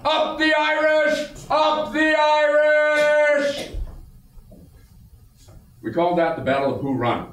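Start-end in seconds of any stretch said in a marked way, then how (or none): 0.0-1.3 rallying cry. Acted. 1.5-3.7 rallying cry. Acted.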